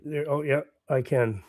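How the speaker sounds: ordinary delivery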